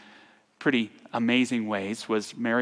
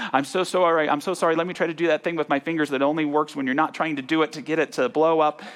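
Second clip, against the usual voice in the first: hurried, higher, louder apologizing; flustered apology; 4 semitones higher, 4 dB louder, faster than usual. Retold or imitated. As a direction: rattle off an embarrassed apology in a rush. Retold flustered apology at boarding gate.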